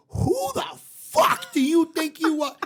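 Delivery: mother tearing into the caller on the radio, imitated angry yell; outraged and indignant, drawn out. Mother rips into the prank caller, angry mock yell.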